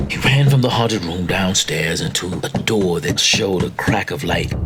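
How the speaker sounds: ordinary delivery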